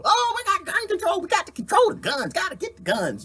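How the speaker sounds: high mock voice of a gun-control advocate. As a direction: parody someone pushing gun control. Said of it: "'Cause somebody says gun control".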